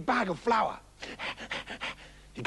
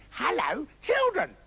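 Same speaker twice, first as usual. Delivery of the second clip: high, carefully nice greeting; wolf practising sounding nice; wolf's nice voice; 7 semitones higher, slower than usual. Acted. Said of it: "practiced speaking with a nice voice".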